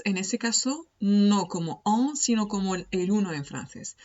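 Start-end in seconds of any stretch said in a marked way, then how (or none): none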